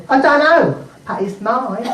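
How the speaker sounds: lightly voiced small boy; a touch of childish possessiveness; Billy. Boy answering his cousin in the bath, lightly childish. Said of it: "And Billy said".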